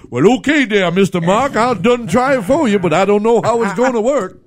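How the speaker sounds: joking impression of Shaq in the Amos and Andy style. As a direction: ham up the old minstrel-show dialect. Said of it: "like an Amos and Andy".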